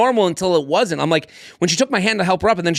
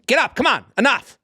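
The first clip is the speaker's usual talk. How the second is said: higher, impatient retold command; annoyed urging; 8 semitones higher than usual. Annoyed date trying to lift a stranger, impatient urging. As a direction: act out losing patience with someone. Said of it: "I got annoyed".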